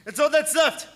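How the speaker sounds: mate throwing himself into the sea; desperate shout building to scream. Acted. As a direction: break into a scream as you jump. Mate leaps overboard screaming.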